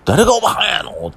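mock indignant snap, pitched well up; retold angry mom. Mom offended at being called old lady, mock indignant retort. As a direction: play the offended mother, sharp and high. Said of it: "うちのおかん怒ってて".

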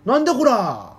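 mock rough street quarrel; angry Kanto man. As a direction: bark like a gruff old man. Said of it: "喧嘩してるおじさんたち".

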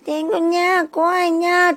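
a frightened little cat crying nya; scared cat voice. Acted. Cat in the princess's arms fears the tengu, high meowing cat.

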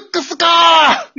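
a high, slow wailing shout; anguished cry. Acted. Teacher wailing on hearing the vice principal's reason. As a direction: howl it in defeat.

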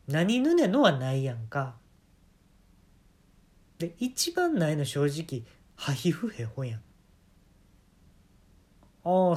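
normal talking voice

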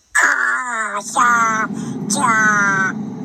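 slow hissing jet engine noise; mouth airplane noise. Shown as a demonstration. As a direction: make the plane roar with your mouth. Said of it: "口でやってました".

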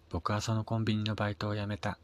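plain reading voice

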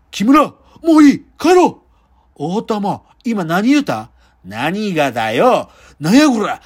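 rough Kansai yakuza threats, barked; menacing gangster quarrel; yakuza movie voice. Acted. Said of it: "ヤクザの映画って".

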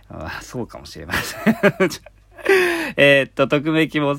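laughing while conceding. Real, not acted. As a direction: say it through a laugh. Admits rumour, laughing.

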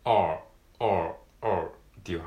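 teacher showing the R; English R. Shown as a demonstration. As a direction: say R with a hollowed spoon tongue. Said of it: "スプーンのようにくぼませて".

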